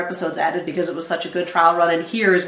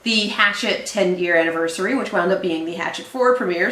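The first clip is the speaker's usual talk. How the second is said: ordinary delivery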